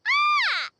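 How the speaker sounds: high, drawn-out regal "ah!"; delighted cry. Acted. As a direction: cry out high as the valet arrives. Queen greets her supposed valet.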